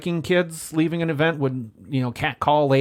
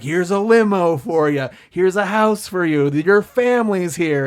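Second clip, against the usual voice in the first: louder, higher, listing each gift; mock grand presenting; 4 semitones higher, 6 dB louder than usual. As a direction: present it all with exaggerated fanfare. Mimics the grand proposal set-up.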